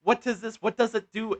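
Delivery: loud, high-pitched interrogation; mock airport officer. Airport security questioning a traveller's melatonin, loud hammed-up interrogation. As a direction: fire questions as an exaggerated indignant official. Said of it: "and they were like".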